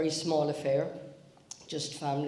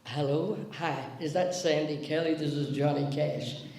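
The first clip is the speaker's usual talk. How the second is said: [name]. Retold or imitated. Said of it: "this voice on the other end said".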